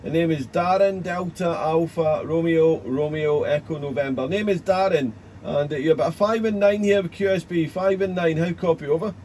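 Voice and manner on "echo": usual speaking voice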